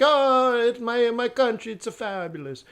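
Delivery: high, gushing mock Italian accent. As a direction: play a proud Italian praising home. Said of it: "and he's Italian".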